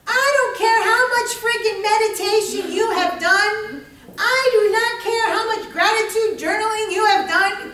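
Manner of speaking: high, loud, emphatic protest; exasperated rant. Acted. Coach railing at people who meditate, loud exasperation. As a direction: pitch it up and hammer it out.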